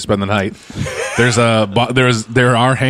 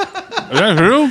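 laughing; 9 semitones higher, much slower than usual. Real, not acted. Laughing disbelief.

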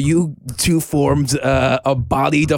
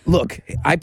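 normal talking voice

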